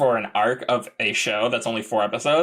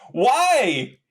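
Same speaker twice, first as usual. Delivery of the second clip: exasperated why; 10 semitones higher than usual. Real, not acted. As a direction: throw up your hands. Exasperated retelling.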